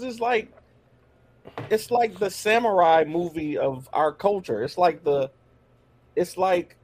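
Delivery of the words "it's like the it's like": normal talking voice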